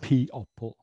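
"P-opple" with the hidden letters sounded; spelling voice. Shown as a demonstration. Teacher showing a spelling pronunciation. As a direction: sound "People" as it's spelt. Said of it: "We say".

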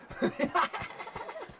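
high-pitched burst of laughter; giggling up high; high giggle. Real, not acted. Laughs at chat.

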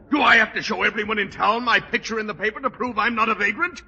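ordinary delivery

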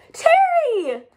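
very high, loud call of surprise; surprised exclamation. A narrator's reading. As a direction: call her name in delight. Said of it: "exclaimed Lemon".

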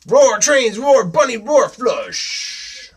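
high, loud punchy roars; roaring little dinosaur. Acted. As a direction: shout each item after a roar. Dinosaur roars through the challenge.

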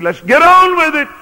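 high, loud, brisk let's-get-going call; eager impatience. Retold or imitated. Jesus eager to go to Calvary, impatient and loud. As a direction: push ahead like nothing can stop you.

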